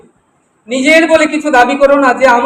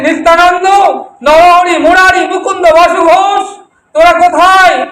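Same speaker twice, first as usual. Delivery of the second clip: higher, urgent mother's summons; loud calling out; 4 semitones higher than usual. Acted. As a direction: call the names out one by one. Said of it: "জোরে ডাকে না".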